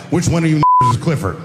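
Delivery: mock cop. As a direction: play the bored cop barging in. Police voice in a joke.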